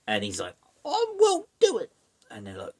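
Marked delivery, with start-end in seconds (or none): 0.8-1.9 loud squeaky falsetto protest; hammed-up falsetto defiance; mock defiant refusal. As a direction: refuse in a very high cartoonish voice. Voicing Steven refusing the cows.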